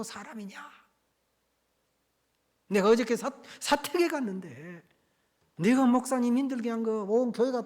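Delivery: high, loud rebuke that climbs to the final cry; stern scolding. Retold or imitated. Elder deacon rebuking the wayward deacon by phone, loud and stern. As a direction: scold him like a church elder setting him straight.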